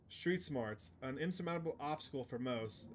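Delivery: usual speaking voice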